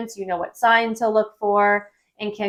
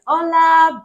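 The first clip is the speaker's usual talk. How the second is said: welcoming hola; 7 semitones higher, 7 dB louder than usual. Pretend. Resort staff greeting arrivals.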